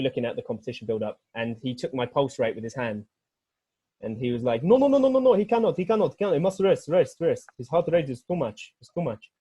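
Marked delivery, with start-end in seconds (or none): 4.6-9.3 agitated mock Albanian accent, high and loud at first; Albanian dad's accent. Albanian dad stops a lifter at competition, frantic Albanian accent. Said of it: "That was a great accent".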